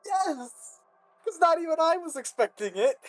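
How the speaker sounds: high, cracking voice. Real, not acted. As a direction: voice cracks with laughter. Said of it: "I think I just had a voice crack".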